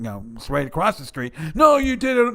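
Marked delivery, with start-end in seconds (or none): 1.5-2.3 mock shout. Acted quarrel with mother.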